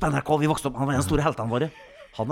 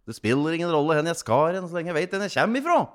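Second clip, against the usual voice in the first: lightly voiced cartoon character, pitched up; Tauebil; 6 semitones higher, 4 dB louder than usual. Actor quoting his character's life motto, lightly in character. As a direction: recite a motto in a higher character voice. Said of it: "Livsmotto til Tauebil".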